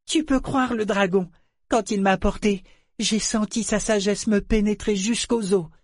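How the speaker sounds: put-on high child's voice, full of awe; earnest little boy. A narrator's reading. Boy vouches for the dragon's wisdom, earnest. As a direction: vouch for it in a small boy's voice.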